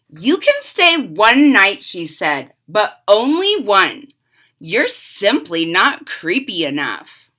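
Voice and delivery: put-on lively read-aloud for kids; storybook narration. A narrator's reading. Reading a witch story to children, lively narration. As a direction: tell it like a bedtime picture book.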